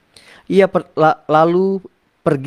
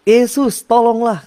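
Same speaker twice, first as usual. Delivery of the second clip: desperate plea; 9 semitones higher, 4 dB louder, slower than usual. Retold or imitated. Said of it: "dicerita sama Yesus".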